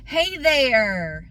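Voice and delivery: loud, high, overdone chummy greeting; mock friendly. New sales guy sitting down with customer, mock friendly. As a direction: imitate a salesman being all friendly. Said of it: "he sits down all friendly".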